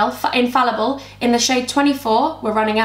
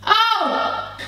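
high burst of laughter; laughing; 8 semitones higher than usual. Real, not acted. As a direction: laugh at your own slip. Laughing at own mistake.

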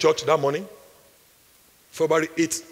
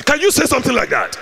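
ordinary talk

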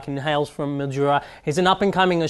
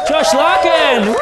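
long booming shout of the name; grand entrance announcement; 11 semitones higher, 8 dB louder, much slower than usual. Acted. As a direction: announce him like a boxing champ. Host announcing guest entrance.

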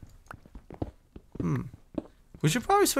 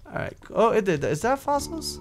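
normal talking voice

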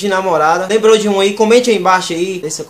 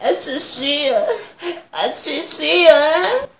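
put-on much higher, slow cooing baby talk; 9 semitones higher, 4 dB quieter, much slower than usual. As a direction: coo like talking to an infant. Voz de bebê aguda. (vozinha aguda de bebê, bem lenta)

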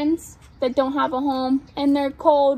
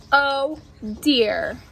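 ordinary delivery

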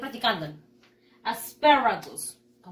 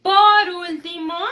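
big reveal; 6 semitones higher, 9 dB louder than usual. Acted. Teacher announcing a mystery vegetable.

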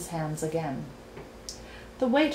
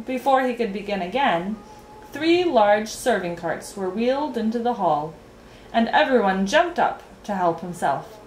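ordinary delivery, nothing marked

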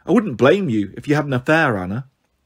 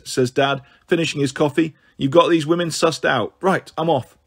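usual speaking voice

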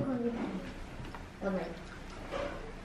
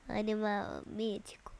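whispering; 8 semitones lower, 15 dB quieter than usual. Real, not acted. Hinting at a mythical animal.

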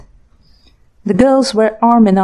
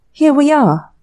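cheerful and chirpy, pitched higher; bright; 7 semitones higher than usual. A narrator's reading. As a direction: sing it out brightly. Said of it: "said Grace brightly".

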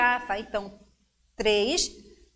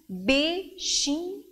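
"bichinho" slowly in separate beats; stretched syllables with gaps in "bichinho"; 3 semitones higher, slower than usual. Shown as a demonstration. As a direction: break "bichinho" into bi, chi, nho. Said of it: "Três".

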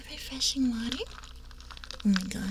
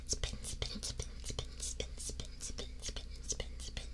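ASMR whisper; 14 dB quieter, slower than usual. Acted. Chanting while wheel spins.

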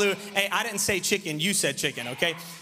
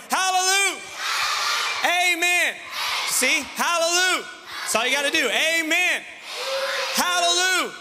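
rousing call-and-response; 7 semitones higher than usual. Real, not acted. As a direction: whip the crowd into shouting back. Leads shouted call-and-response.

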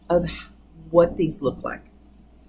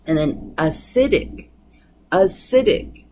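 acidic, stressed on SI, the second-to-last syllable; stress on the penultimate. Shown as a demonstration. Teacher showing the class acidic stressed on SI. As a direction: land the beat on SI in acidic. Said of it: "You can hear it on the second to the last".

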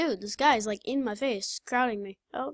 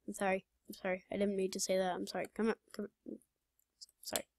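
soft mock apology; 4 semitones lower, 10 dB quieter, much faster than usual. Apologizing to in-game character.

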